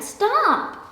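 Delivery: high, loud cry to halt; exclaiming. A narrator's reading. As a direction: shout stop before the boot falls. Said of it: "exclaimed the little red ant".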